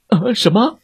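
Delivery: high, startled question; surprised. Acted. Bears hearing a stranger's voice, high surprise. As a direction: react as if caught off guard.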